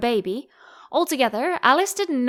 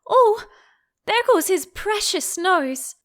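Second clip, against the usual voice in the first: high, loud gasp of dismay; alarmed cry; 9 semitones higher, 4 dB louder than usual. A narrator's reading. Alice watching a saucepan near the baby, alarmed. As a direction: cry out as the pan flies past.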